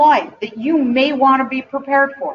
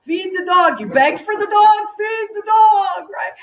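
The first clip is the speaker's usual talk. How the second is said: put-on much higher and loud, a fed-up shout at the kids; mother shouting at her teenager to feed the dog, exasperated; exasperated parent yelling; 10 semitones higher, 4 dB louder than usual. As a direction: yell it like a mom who has asked ten times. Parent nagging teens about dog.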